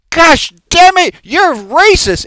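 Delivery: high, loud, slow angry outburst; heated accusation. Shown as a demonstration. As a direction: hurl it like an accusation with real heat. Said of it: "with so much heat behind it".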